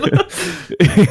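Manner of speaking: bright burst of laughter; laughing. Real, not acted. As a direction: laugh out loud, high. Laughing at joke.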